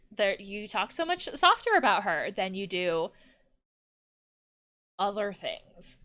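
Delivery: ordinary delivery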